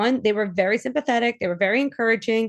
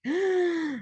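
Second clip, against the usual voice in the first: sharp excited intake of breath; mock gasp; 8 semitones higher, 5 dB quieter than usual. Woman acting out her own realisation, with a sharp excited gasp. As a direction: gasp as if struck by a revelation.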